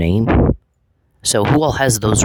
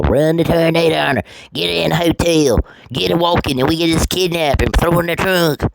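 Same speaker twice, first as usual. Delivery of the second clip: mocking Gordy's talk; 7 semitones higher than usual. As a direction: ape his way of talking, pitched well up. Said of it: "talks like that, Gordy?".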